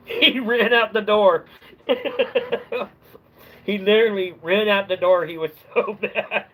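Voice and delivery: can't stop laughing at the memory; real laughter breaking into the words. Real, not acted. Laughing at the customer fleeing.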